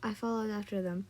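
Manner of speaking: usual speaking voice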